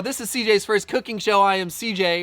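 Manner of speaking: upbeat TV host intro; show host intro. Acted. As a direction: present it like a cooking show host.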